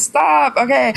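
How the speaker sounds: high yelped stop; protesting stop. Real, not acted. Guest cutting off the teasing host, a high protesting yelp. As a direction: yelp it high to cut him off. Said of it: "cuts off teasing friend".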